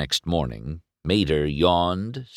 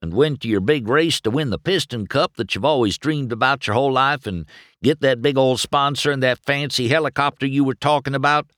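Mater explaining; 7 semitones higher, 3 dB louder than usual. A narrator's reading. Narrator voices Mater rambling on.

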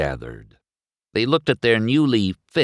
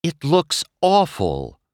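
blunt; 9 semitones higher, 3 dB louder than usual. A narrator's reading. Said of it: "Sally cut to the chase".

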